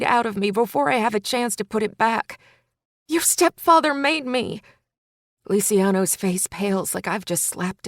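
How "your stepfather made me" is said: higher, louder confession; woman crying out the stepfather's guilt; desperate cry. A narrator's reading. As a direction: shout it out in despair. Continuing the scream.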